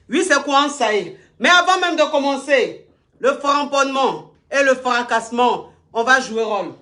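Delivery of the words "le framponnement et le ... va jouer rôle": usual speaking voice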